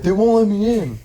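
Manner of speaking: high whining complaint; whiny friend. Retold or imitated. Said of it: "he was like".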